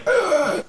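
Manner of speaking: high winded "ugh", acted out; out-of-breath groan. Player voices character doubled over on the rail, winded groan. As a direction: groan like the wind got knocked out. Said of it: "out of breath, just like".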